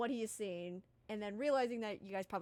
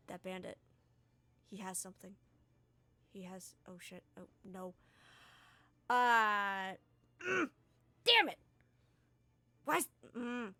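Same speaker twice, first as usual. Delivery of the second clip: frustrated timid Ravine; 2 semitones lower, 4 dB louder than usual. Acted. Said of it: "you see him so frustrated".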